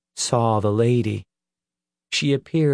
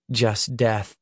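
ordinary delivery

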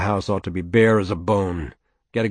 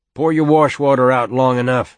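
father's pronouncement; 5 semitones higher, 8 dB louder than usual. Acted. Father lecturing on his dooryard.

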